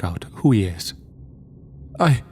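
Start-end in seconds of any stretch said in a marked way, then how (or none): 2.0-2.2 put-on high, nervous stammer from a scared kid; stuttering. A narrator's reading. Scared kid stammering to the man holding him. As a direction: stutter it out, losing nerve. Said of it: "he stutters".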